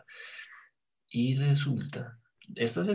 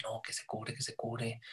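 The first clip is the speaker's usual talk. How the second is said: quiet prayer; 8 dB quieter, slower than usual. Retold or imitated. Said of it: "a rezar".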